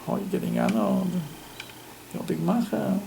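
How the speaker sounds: a hint of a smug tempter, soft; gloating evil inclination. Evil inclination gloating at the honoured son-in-law, lightly voiced. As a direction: let the inner tempter gloat quietly. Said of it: "my evil inclination is happy".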